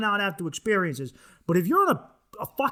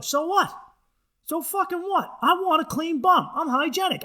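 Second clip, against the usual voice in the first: worked-up rant; 9 semitones higher than usual. Real, not acted. Ranting at bidet doubters, higher and worked up. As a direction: defend it with rising heat.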